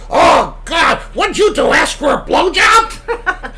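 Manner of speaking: loud high parrot cry of horror; shocked parrot shout. Retold or imitated. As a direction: shriek the punchline like an appalled parrot. Parrot punchline to frozen chicken.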